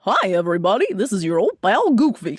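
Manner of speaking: Goofy greeting with a laugh breaking in; laughing through a Goofy greeting; Goofy voice with a chuckle; Goofy impression. Shown as a demonstration. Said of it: "then we're gonna try the laugh".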